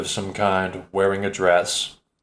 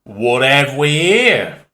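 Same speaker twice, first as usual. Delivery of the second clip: much slower, louder irritated Cockney repeat; 4 semitones higher, 4 dB louder, much slower than usual. Acted. Cockney man repeating himself when ignored, irritated. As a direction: drag it out, annoyed Cockney. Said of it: "the voice speaks louder, irritated".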